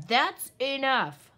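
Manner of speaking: loud, slow stern bark. Acted. Father wolf silencing his son, loud slow bark. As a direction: cut him off hard like an angry father. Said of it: "barked Father Wolf".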